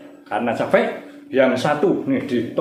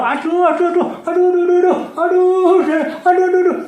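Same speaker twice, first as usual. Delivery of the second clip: high, repeated yelps of a beaten soldier; hammed-up cries of pain; 14 semitones higher, 4 dB louder, faster than usual. Beaten Amalekite wails in pain, high hammed-up yelps. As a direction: play the struck enemy wailing. Enemy hit and crying out.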